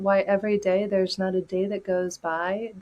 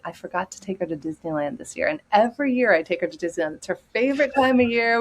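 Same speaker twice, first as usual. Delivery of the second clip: usual speaking voice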